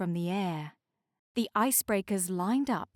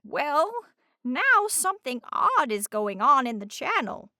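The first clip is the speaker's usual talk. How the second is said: lighthouse lady voice; 5 semitones higher, 4 dB louder than usual. A narrator's reading. Lighthouse character voice.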